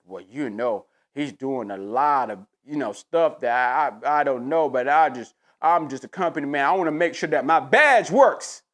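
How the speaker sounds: low, hesitant mock of a corporate loyalist; mock Stephen A. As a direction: play him fumbling then proud, voiced low. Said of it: "Stephen A is on Big Boy and say".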